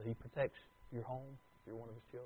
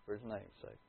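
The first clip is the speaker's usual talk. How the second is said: "For His name's sake." repeated much softer and lower; quiet echo; 7 semitones lower, 16 dB quieter than usual. Shown as a demonstration. Preacher repeating the phrase to his congregation, hushed. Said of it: "I want you to listen to this statement".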